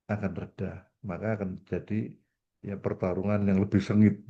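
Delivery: ordinary talk, nothing marked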